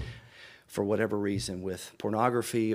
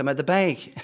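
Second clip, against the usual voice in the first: louder, higher retold outburst; panicky answer; 5 semitones higher, 6 dB louder than usual. Husband freaking out on the phone to his wife, panicky and loud. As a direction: blurt it as a freaked-out husband, half comic.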